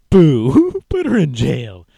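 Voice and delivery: loud, high jeering boo, then a laugh; mock jeer; mock booing crowd. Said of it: "and they're like".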